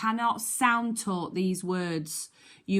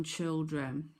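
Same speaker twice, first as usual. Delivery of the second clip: ordinary talk, nothing marked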